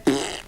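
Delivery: low, soft raspberry; imitated fart noise. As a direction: blow a low mock fart sound. Imitating fart noise as teacher sat.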